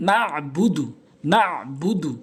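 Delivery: না বুদু with a mid-throat ayn closing না; correct, with ayn. Shown as a demonstration. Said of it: "আইনটি হলকের মাঝখান থেকে".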